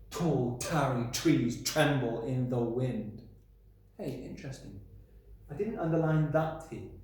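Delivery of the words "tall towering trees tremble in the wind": tall towering trees tremble with each t slow and aspirated; aspirated t stress. Shown as a demonstration. Teacher repeating the example sentence for the class. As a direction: hit every t slowly. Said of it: "we've got t".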